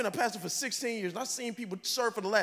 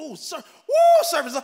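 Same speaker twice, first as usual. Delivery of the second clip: mock cheer; 6 semitones higher, 10 dB louder than usual. Pastor imitating an excited server, whooping. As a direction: build to a giddy yelp.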